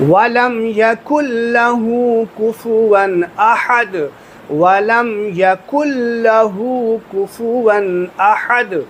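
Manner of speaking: وَلَمْ يَكُنْ لَهُ with the نون merged, chanted; correct recitation. Shown as a demonstration. Teacher recites the correct way for students, chant. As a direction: recite it melodically, كاف joined to لام. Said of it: "یہ ہے صحیح طریقہ".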